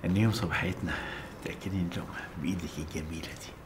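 soft intimate whisper. Acted. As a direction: breathe it close and tender. Husband's tender intimate request.

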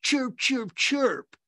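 high bird-like chirps; imitated twittering in someone's ear; mock chirping. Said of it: "You just whisper in her ear".

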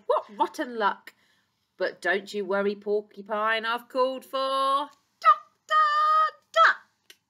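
loud storybook zookeeper, building to a big finish; zookeeper's rallying call. Acted. Zookeeper reassures poorly Porcupine, rallying call. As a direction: build up and punch out the name.

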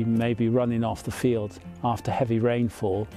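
usual speaking voice

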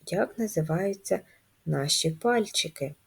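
ordinary talk

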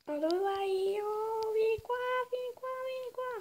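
coaxing a puppy, high and fast; squeaky coaxing call; high-pitched calling. Real, not acted.

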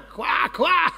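loud, high imitation croaking; frog croaks. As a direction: croak twice like a frog, loudly. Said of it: "על הצפרדע".

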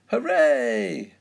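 put-on high, loud child's hooray; shrieked little-girl celebration; joyful cheer. A narrator's reading. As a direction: cheer at the top of your voice. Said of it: "cheers Peppa".

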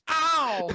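high, loud ow; mock pain cry. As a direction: yelp as if just knocked down. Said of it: "This is Ray Rougeau".

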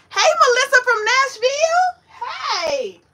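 high, loud, bright shout-out to a viewer; excited greeting. Real, not acted. Greeting a viewer from Nashville, excited loud hello. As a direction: call out a happy hello across the room.

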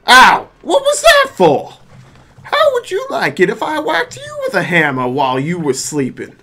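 high, loud outraged yelp into scolding; indignant game character. Acted. Paint can whacked awake by a hammer, indignant.

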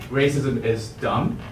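normal talking voice

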